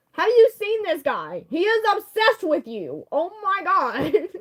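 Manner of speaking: high, loud, slow disbelief; exasperated outburst. Real, not acted. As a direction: gush at how obsessed he is. Exasperated reaction to obsessed character.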